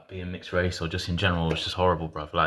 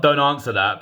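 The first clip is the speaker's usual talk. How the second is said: stern lawyer warning; 7 semitones higher, 7 dB louder than usual. Shown as a demonstration. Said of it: "but not like a lawyer".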